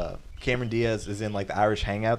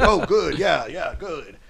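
pleased butcher, higher and louder with a laugh; Daniel Day-Lewis butcher impression; 6 semitones higher, 7 dB louder, slower than usual. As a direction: praise the kid like a proud butcher. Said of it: "You do it way better than me".

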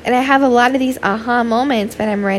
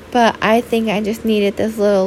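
usual speaking voice

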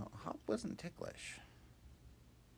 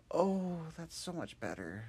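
relieved Ruff; 11 semitones higher, 9 dB louder than usual. Acted. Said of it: "And Ruff went".

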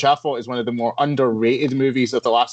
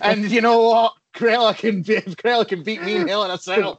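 wrestling promo; 10 semitones higher than usual. Acted. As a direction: sell it like a heel's ring entrance. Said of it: "like a wrestling promo there".

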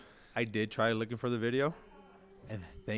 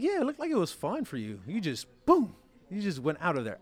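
high, light imitation of the girlfriend; girlfriend's voice; 7 semitones higher, 4 dB louder than usual. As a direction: mimic her teasing concern, pitched high. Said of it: "She's like".

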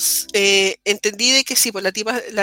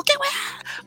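mock yell; 6 dB quieter than usual. Competitive gamer retelling an outburst, mock yell. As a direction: imitate a sudden squeal of outrage, held back. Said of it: "de repente grito y digo".

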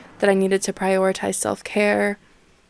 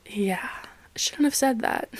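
regretful whisper; 2 semitones higher, 5 dB quieter than usual. Real, not acted. Regretting a comment, said under breath.

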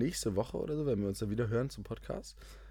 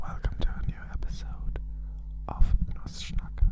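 ASMR whisper; 5 semitones lower, 6 dB louder than usual. Acted. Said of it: "funktioniert das hier auch mit dem A S M R".

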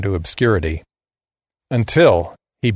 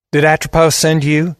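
put-on young Steve's southern drawl, pitched up; higher-voiced southern kid, challenging; 6 semitones higher, 3 dB louder than usual. A narrator's reading. Southern boy asks the stranger at the crossroads, in a drawl. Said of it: "He had a deep southern drawl".

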